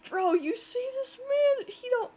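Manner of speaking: outraged. Real, not acted. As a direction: call out the creep, shocked. Outraged at character's stare.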